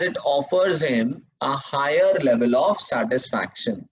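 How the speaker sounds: very slow, each phrase repeated; teacher dictating notes word by word; slow dictation. Shown as a demonstration. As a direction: dictate the definition for students to copy. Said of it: "dictating definition to class".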